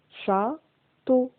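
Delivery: "Château" split as châ, pause, teau; syllable by syllable. Shown as a demonstration. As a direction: sound out "Château" in two detached syllables. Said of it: "dans la deuxième syllabe". (« Château » en deux syllabes bien séparées)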